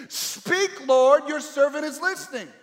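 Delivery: high, loud ringing plea; loud pleading prayer; fervent cry. Shown as a demonstration. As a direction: proclaim it to the whole room. Preacher voices the prayer aloud.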